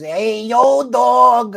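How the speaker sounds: a hint of the annoying Philly YouTuber's accent, hammed up; mock Philly accent. Streamer mocking the Philly YouTuber's greeting in a put-on Philly accent. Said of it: "the most annoying voice in the world".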